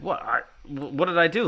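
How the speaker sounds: high, stammered mock protest; baffled defensiveness. Man answering friends who called him creepy, baffled defence. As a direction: protest your innocence, stumbling into it.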